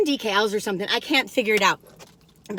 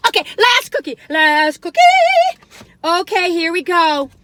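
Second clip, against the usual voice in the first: high, loud and giddy; giddy about the last cookie; excited; 5 semitones higher, 6 dB louder than usual. Real, not acted.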